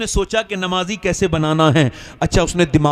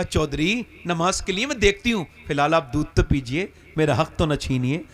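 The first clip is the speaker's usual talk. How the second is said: soft, tender coaxing, settling lower; loving; 4 semitones lower, 5 dB quieter than usual. Acted. Wife offers her husband milk after his rebuke, lovingly. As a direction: coax him gently and quietly. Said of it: "उसने इतने प्यार से बात की".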